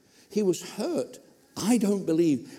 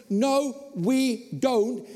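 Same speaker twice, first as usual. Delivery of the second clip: slow emphatic no; 6 semitones higher, much slower than usual. Real, not acted. Preacher correcting the congregation, slow and firm. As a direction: draw out No, we don't slowly and firmly.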